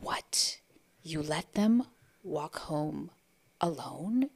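whispered, slow shocked question; hushed disbelief. Retold or imitated. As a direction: gasp it out quietly, aghast. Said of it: "her face was just like".

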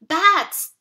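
loud, high shriek. Acted. Scared brother shrieking at the sound of bats. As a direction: yelp it out in fright. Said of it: "Tim shrieked".

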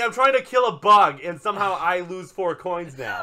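loud exasperation. Real, not acted. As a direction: protest loudly at the game. Outburst at losing coins in game.

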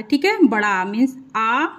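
usual speaking voice

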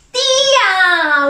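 "tía" blended into one drawn-out word; sounds joined. Shown as a demonstration. Teacher revealing the family word to children, sounds joined. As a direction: join "tía" up without a break, as a reveal. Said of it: "¿qué dirá?".

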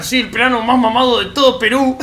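a put-on Peruvian accent, playfully boastful; mock Peruvian accent. Streamer answering online insults in mock Peruvian accent. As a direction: ham up a Peruvian voice, half laughing. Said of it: "Y después me miran".

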